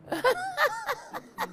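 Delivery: high delighted laugh; bright laugh breaking out; burst of laughter. Real, not acted. Laughing at the story.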